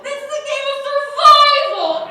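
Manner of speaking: loud, high indignant shout; mock outrage. Contestant yelling at the idea of forfeiting, high and loud. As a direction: blow up at the very idea of quitting.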